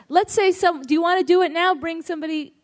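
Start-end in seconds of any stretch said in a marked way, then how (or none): none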